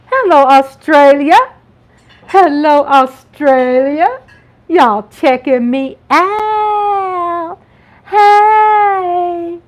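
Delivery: loud, drawn-out high hail; calling out. Real, not acted. Vlogger hailing her Australian viewers, calling out. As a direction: holler a long hello to a far-off crowd.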